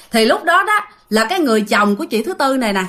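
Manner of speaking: plain reading voice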